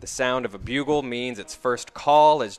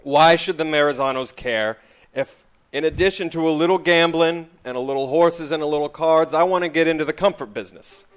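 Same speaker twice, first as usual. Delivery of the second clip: exasperated sigh of a demand, raised and louder; frustrated; 5 semitones higher, 6 dB louder than usual. Acted. Track owner tired of going in circles with a mobster, frustrated. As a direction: push the questions out, fed up. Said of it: "He clearly looks frustrated".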